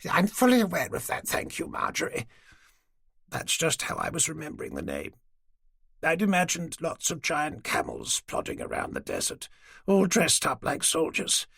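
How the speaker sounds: put-on an old lady patiently humouring the police; elderly lady. Dorothy defending her memory.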